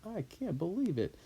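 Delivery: acted incredulous questioning; emphatic disbelief. Shown as a demonstration. Teacher showing what nam adds, incredulous emphasis. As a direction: lean hard on each question as if you can't believe it. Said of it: "it just adds more emphasis".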